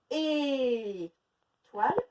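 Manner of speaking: Étoile very slow, ending long and rising; slow syllables. Shown as a demonstration. Teacher searching for the sound with pupils, slow. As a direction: stretch Étoile to find the E. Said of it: "il se trouve au début ou à la fin?".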